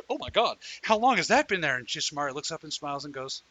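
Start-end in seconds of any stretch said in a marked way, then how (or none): 0.0-1.8 high, loud mock horror; shocked discovery. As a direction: relive the moment of spotting it.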